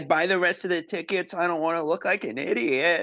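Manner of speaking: high-pitched mock pleading; pleading son. Tony begging his dad to buy tickets, high mock plea. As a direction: beg like a kid in a squeaky put-on voice.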